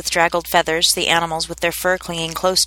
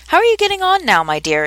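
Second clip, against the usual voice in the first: kindly mouse; 11 semitones higher than usual. A narrator's reading. Mouse turns kindly to Alice.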